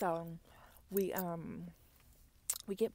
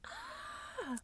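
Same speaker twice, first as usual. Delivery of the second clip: imitated freaked-out scream. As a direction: mimic a frightened boy's shriek, held back in volume. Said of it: "My son seen that and freaked out".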